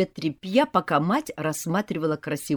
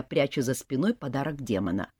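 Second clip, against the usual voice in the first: ordinary delivery, nothing marked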